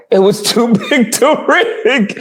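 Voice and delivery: slow, laughing retelling; laughing mock quote. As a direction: stretch it out, breaking into laughter. Laughing while quoting a claim.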